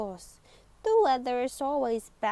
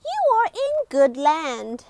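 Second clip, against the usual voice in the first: higher and much louder lady; loud woman's voice; 4 semitones higher, 9 dB louder than usual. Acted. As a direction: announce it proudly and loud.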